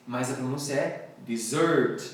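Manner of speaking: normal talking voice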